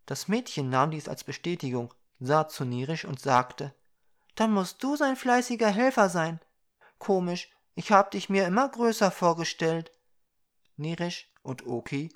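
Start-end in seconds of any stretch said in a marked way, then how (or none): 4.4-9.9 put-on high-pitched child character; curious little girl. A narrator's reading. Little girl sizing up the dwarf helper, high child voice. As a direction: lift into a small girl's voice. Girl figure in narrated story.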